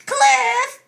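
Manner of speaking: high loud yelp of alarm; a sharp high alarmed yelp. A narrator's reading. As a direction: yelp the word in sudden alarm. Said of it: "Olive yelped".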